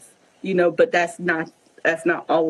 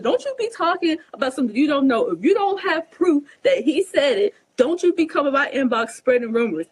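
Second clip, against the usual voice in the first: higher-pitched, indignant telling-off; standing up for herself; 6 semitones higher than usual. Retold or imitated. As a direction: retell putting a gossip in their place. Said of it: "standing up for myself".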